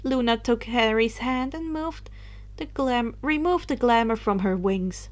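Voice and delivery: plain reading voice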